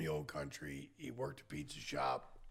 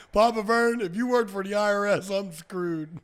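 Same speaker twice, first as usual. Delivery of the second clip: loud, high, mock alarm; joking exclamation; 6 semitones higher, 15 dB louder than usual. Telling Papa Vern he'd be ruined by him, loud mock alarm. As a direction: cry out in playful dismay. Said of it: "I said".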